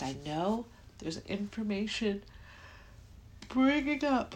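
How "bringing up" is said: words stretched through a real yawn. Real, not acted. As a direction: let a yawn take over the words. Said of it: "look, it's doing it again".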